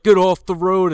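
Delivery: loud, high shout at a driver; hammed-up yell; mock angry order. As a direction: imitate an irate man yelling from the roadside. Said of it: "this fucking British guy".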